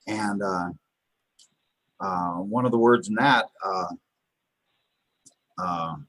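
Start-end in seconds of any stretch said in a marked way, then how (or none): none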